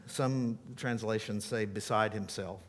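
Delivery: ordinary delivery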